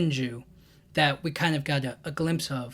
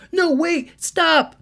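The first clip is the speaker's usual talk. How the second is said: high-pitched panicky plea, a bit louder; mock panic; 11 semitones higher, 4 dB louder, much slower than usual. Guards begging Boruto to stop, high panicky cry. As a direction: guards crying out for him to stop. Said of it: "And they're like".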